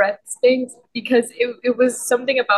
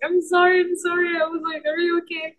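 high, worried repeated sorry; mock panicked sorry; panicked apology; 8 semitones higher than usual. Retold apology after slamming him.

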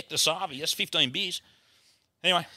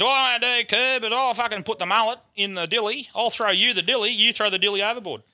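mate giving instructions; 6 semitones higher than usual. Retold or imitated. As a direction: act out your mate laying down the plan. Acts out Bert giving orders.

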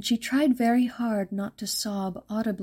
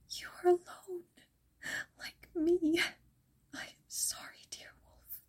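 higher, tearful whisper fading away; 10 semitones higher, 7 dB quieter than usual. A narrator's reading. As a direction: pity the lonely wolf through tears. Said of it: "tears welling in her eyes".

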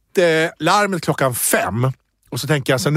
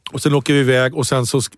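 ordinary talk, nothing marked

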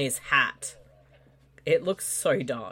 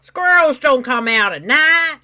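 mock quote; 9 semitones higher, 10 dB louder than usual. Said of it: "this, he just said".

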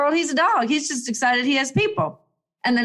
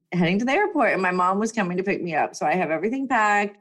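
ordinary delivery

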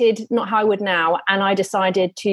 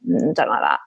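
low flat husband's dismissal, much lower; unimpressed husband; 10 semitones lower than usual. Retold or imitated. Husband rejecting the lingerie, low unimpressed grunt. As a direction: drop deep and bored as the husband. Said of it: "He was like".

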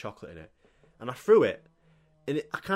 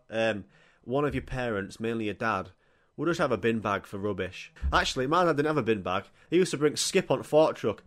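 normal talking voice

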